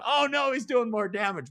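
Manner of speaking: high, alarmed fretting voice; mock worried listener. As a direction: play a fussing listener in a panic. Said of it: "people listening going".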